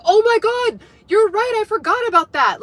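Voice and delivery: high, loud burst of remembering; sudden realization. Retold or imitated. Coworkers reminded of the story later, imitated in high surprise. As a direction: exclaim it as if it just came back.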